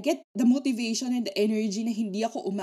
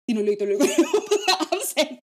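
laughing; 6 semitones higher, 8 dB louder than usual. Real, not acted. Laughing retelling to teacher.

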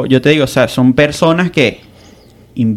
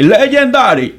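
mock old legend; 10 semitones higher, slower than usual. Mocking old Daddy Yankee.

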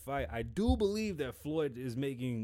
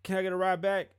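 mock pleading ask; 4 semitones higher, 7 dB louder than usual.

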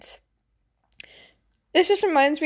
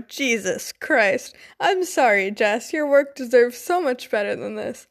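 plain reading voice

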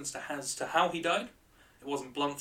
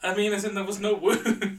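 laughing while explaining; 7 semitones higher, 8 dB louder than usual. Real, not acted. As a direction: giggle through it. Laughing at partner.

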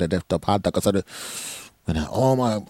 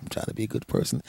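soft, breathy whisper; whispered to himself; 8 dB quieter, faster than usual. Real, not acted. Reminding himself to be good, whispered. Repeated under his breath.

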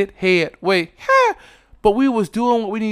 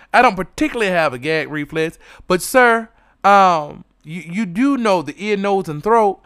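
usual speaking voice

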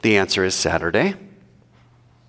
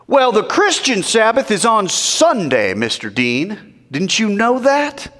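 mocking voice; 11 semitones higher, 3 dB louder, slower than usual.

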